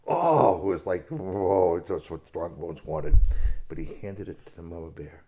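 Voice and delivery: ordinary delivery, nothing marked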